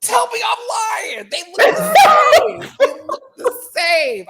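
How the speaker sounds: loud, high and slow; shouting. Real, not acted. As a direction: yell it in disbelief. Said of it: "insisting loudly".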